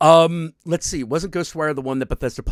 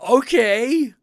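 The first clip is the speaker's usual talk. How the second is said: ordinary delivery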